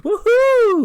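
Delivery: huge high shrieking woohoo; wild woohoo. Retold or imitated. Corbin walking off after his brag, shrieking a wild woohoo. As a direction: let out a wild triumphant whoop. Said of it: "He's like".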